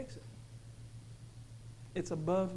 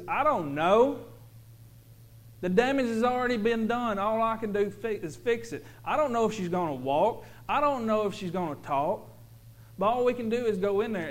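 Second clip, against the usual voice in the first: raised, louder retold doctor; quoting the doctor; 5 semitones higher, 6 dB louder than usual. Preacher voicing the doctor to his congregation, a touch raised. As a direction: voice the doctor giving bad news firmly.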